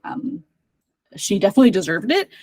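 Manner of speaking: usual speaking voice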